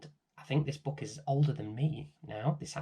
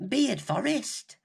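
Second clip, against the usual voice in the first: put-on high, slow little girl voice; little girl Rose; 12 semitones higher than usual. Rose answering the village children in a high little girl voice. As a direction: squeak it out like a small girl. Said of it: "Rose told them".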